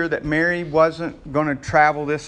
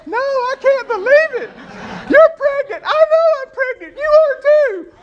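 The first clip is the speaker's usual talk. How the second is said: very high, loud squeals broken by laughter; squealing excited girlfriends; 8 dB louder than usual. Acted. Preacher mimicking women sharing pregnancy news, squealing with delight. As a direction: shriek the news like giddy friends. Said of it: "you girls know how y'all do this".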